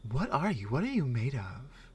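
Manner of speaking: normal talking voice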